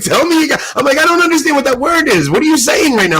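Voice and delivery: high, loud mock-pleading confusion. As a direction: beg him to say it again, half laughing. Begging the priest to repeat.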